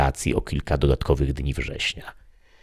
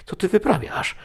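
very high, startled cry; horrified at his partner cutting the birds, high and shocked; shocked outburst. A narrator's reading. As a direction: yelp in disbelief.

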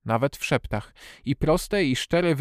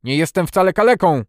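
loud, high indignant cry; angry outburst in narration; furious shout; 6 semitones higher, 9 dB louder than usual. A narrator's reading. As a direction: shout it out, enraged at the insult. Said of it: "wykrzyknął wściekły".

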